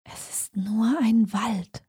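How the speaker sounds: slow, hushed murmur to herself; quietly muttered under the breath; soft murmur. A narrator's reading. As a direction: murmur it softly, drawn out, as self-reassurance. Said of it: "murmelte sie leise".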